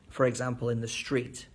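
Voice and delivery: usual speaking voice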